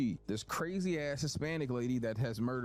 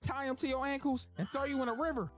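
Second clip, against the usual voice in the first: Madea threatening; 11 semitones higher than usual. Acted. Madea threatens Big Sal at lunch, high furious. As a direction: rant like a furious old lady. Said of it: "Madea fight threat, high voice".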